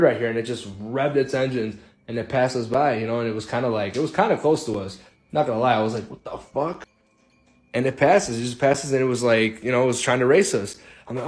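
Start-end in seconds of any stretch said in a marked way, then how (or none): 6.1-6.9 higher, hushed "what the fuck"; startled. Retold or imitated. Car suddenly appearing beside the van, hushed startled reaction. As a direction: caught off guard, under the breath.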